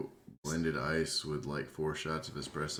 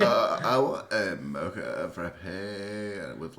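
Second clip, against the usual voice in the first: slow, louder mock girly order; 3 semitones higher, 7 dB louder, slower than usual. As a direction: drag out the order like a valley girl. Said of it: "The like in the middle of every order".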